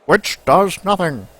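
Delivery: lightly voiced old newsreel narrator; a hint of an old-time newsreel announcer; 1930s narrator. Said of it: "nineteen thirties voice is coming out".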